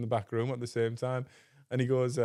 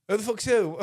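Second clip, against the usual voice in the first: raised, louder retort; mock angry; 9 semitones higher, 5 dB louder than usual. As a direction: imitate an indignant comeback, pitched up. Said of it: "Like, he's angry".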